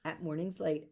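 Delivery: plain reading voice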